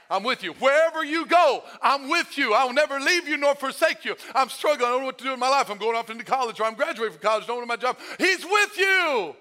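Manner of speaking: high, loud preacher's delivery; full-force preaching. Real, not acted. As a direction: preach it hard, building to a shouted peak. Preaching builds to a shout.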